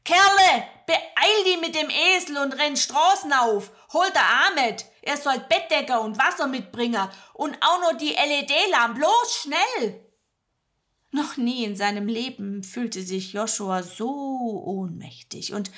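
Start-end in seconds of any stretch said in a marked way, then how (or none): 0.0-10.0 loud, high Swabian woman hurrying him; urgent orders. Acted. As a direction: shout instructions in a rush. Magda orders Joshua to run. (Magda schickt Joshua laut los)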